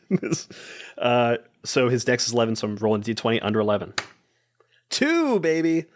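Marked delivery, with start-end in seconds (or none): 4.9-5.8 real excitement at the dice; excited cheer. Real, not acted. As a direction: pitch leaps way up on the roll result. Celebrating a good dice roll.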